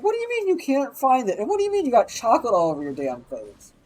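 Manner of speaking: high, incredulous telling-off; exasperated scolding. Retold or imitated. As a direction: scold him in disbelief. Scolding the kid, calling him out.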